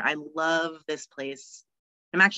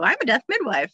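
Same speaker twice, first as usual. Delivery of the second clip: higher-pitched, breezily cheery self-quote; 7 semitones higher, 4 dB louder, faster than usual. Retold or imitated. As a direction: chirp it cheerily, pitch swooping up. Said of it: "I'm, like, so cheery about it".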